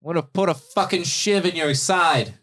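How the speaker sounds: high, loud prisoner's threat; threatening yell. Acted. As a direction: yell vengeful threats at a jailer. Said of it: "he yells, he's like".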